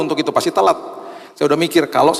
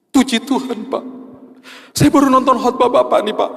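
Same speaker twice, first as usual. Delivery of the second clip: high, tearful voice of a weeping driver; imitating a crying driver, pitched up; 11 semitones higher than usual. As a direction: sob it out in a high, choked pitch. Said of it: "Terus dia nangis".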